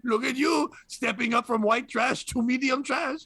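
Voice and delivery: high, sneering mock Frenchman; French accent. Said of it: "answer in a French accent".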